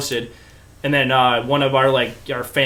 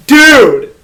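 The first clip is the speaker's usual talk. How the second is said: exasperated scolding; 8 semitones higher, 8 dB louder than usual. Retold or imitated. Everyone scolds the kid.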